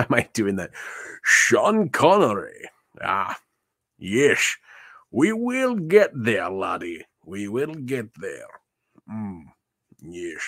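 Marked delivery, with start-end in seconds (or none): 1.2-2.7 put-on Sean Connery's Scottish accent, a touch higher and louder. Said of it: "Is my Sean Connery voice". 3.0-7.1 Sean Connery voice. Pretend. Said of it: "Is my Sean Connery voice". 7.2-10.4 put-on Scottish Connery accent, "We will get there" repeated softer and fading to a murmur; Sean Connery voice. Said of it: "Is my Sean Connery voice".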